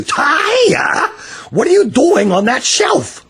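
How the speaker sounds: high, loud startled shout; shocked call. A narrator's reading. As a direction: yell up at her, astonished. Father finds daughter on shelf.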